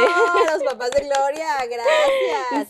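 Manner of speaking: loud, high, near-tearful thanks; loud tearful bravo; emotional cheer. Real, not acted. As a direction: cheer them with a catch in the voice. Host cheers the parents.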